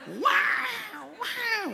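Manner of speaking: berrido muy agudo a pleno pulmón; llanto de bebé imitado. Retold or imitated. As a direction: imita a un recién nacido con buenos pulmones. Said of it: "tenía unos pulmones". (chillido largo de bebé llorando, imitado)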